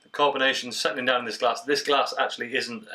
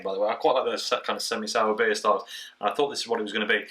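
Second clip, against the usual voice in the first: ordinary talk, nothing marked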